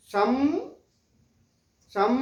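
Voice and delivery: शम and सम closing on a humming म with the lips shut; सम said with the mouth closing on a nasal म; anusvara demo. Shown as a demonstration. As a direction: hum the final म of सम through the nose. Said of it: "देखिए नाक से आवाज निकल रही है".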